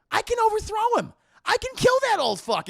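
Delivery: high, loud, boastful shout. Retold or imitated. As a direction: yell it like a whiny tyrant. Anakin boasting, acted shouting.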